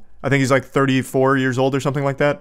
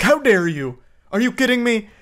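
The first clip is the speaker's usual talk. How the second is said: higher, mock offended gasp of a question; mock outrage; 8 semitones higher than usual. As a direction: play up fake indignation. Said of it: "tries to flip this shit around like".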